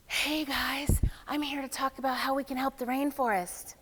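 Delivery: airy, whispery pitch to the group; too much air. Shown as a demonstration. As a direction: let extra air leak through every word. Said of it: "the tone is how much air".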